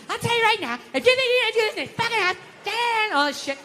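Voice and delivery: high, fast scolding wife. Retold or imitated. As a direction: lay down the law, pitched high. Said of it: "she just wants it".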